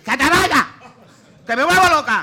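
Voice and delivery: high, very loud furious yell; screaming at the conman to leave; retold scream. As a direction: scream at him to get out. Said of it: "Pero yo así ya grité".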